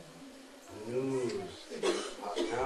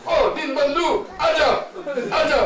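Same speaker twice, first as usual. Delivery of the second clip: much higher, loud hollering; rough calling out; 12 semitones higher, 7 dB louder than usual. Retold or imitated. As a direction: holler across the lane to a friend. Villagers calling out rudely.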